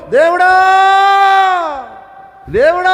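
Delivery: loud, high cry of దేవుడా; calling out. Retold or imitated. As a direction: call out దేవుడా as if to a distant god. False prophets calling out to god. (ఎక్కువ స్వరంతో దేవుడా అని పిలుపు)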